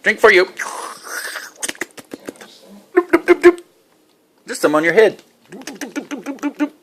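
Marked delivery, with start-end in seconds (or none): 3.0-3.6 high, loud staccato doops; high-pitched silly sound effect for a child; playful doop noises. Pretend. 5.5-6.7 soft, high staccato doops; high, quiet, bouncy sound effect; playful doop noises. Pretend. Playful dabbing sounds for child.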